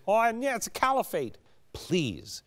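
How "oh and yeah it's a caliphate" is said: high, quick mocking imitation; put-on dismissive voice, high and hurried. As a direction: mimic a scoffer, pitched up and fast. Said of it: "no mocking".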